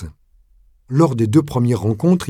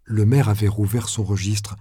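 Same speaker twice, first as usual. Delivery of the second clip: plain reading voice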